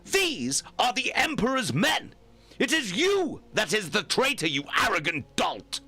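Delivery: angry retort. Acted. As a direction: scold him with contempt. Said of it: "Karya retorted".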